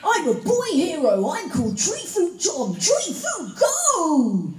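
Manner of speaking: high, loud heroic cartoon boy; boy hero shouting his catchphrase; gung-ho boy hero. Shown as a demonstration. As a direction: belt it like an eager boy hero, dragging out the last line.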